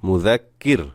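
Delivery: no stretch, stop on র; stopped ending. Shown as a demonstration. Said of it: "রতে সাকিন হয়ে যাবে". (মুযাক্কির, র-তে টান ছাড়া থামা)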